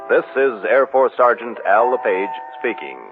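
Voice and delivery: ordinary talk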